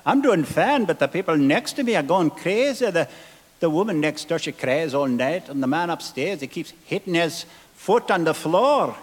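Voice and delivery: a hint of a Scottish boy; Scottish lad. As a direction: son answering his mother, lightly Scottish. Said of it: "And he said".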